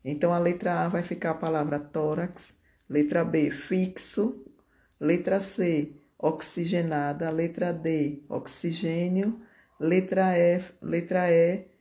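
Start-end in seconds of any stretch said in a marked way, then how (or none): none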